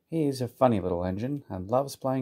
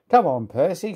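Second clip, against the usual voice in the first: higher, chivvying call; storyteller's cheery driver call; urging on; 6 semitones higher, 4 dB louder than usual. A narrator's reading. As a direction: chivvy the engine into moving. Driver urges Percy to set off.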